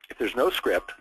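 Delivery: usual speaking voice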